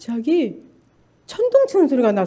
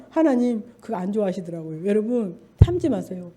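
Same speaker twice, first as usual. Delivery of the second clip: ordinary delivery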